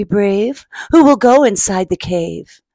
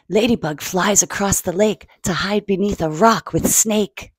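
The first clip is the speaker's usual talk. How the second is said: ordinary delivery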